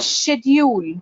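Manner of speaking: "Schedule" slowly as SHED-yool; correct pronunciation. Shown as a demonstration. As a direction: open "Schedule" on SH, drawn out. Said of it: "It's schedule".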